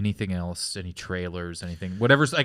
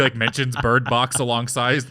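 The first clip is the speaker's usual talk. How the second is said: normal talking voice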